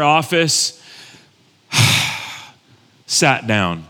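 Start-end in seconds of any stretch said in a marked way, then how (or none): 1.7-2.3 long, audible exhale; re-enacted sigh. Pastor settles into the counselor's office, re-enacted sigh. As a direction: let out a weary-sounding sigh. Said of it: "you sigh a lot".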